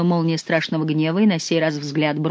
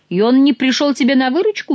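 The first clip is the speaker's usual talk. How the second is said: high, louder incredulous question; raised voice of disbelief; surprised; 8 semitones higher, 5 dB louder than usual. A narrator's reading. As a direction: ask in astonishment, rising at the end. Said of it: "удивился Мажерон".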